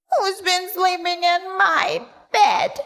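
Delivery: high little cub yell; Baby Bear yelling. A narrator's reading. Baby Bear yells about his bed, high. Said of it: "yelled Baby Bear".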